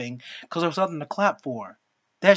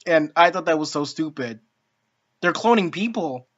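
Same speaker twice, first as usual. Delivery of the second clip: ordinary talk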